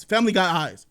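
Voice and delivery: normal talking voice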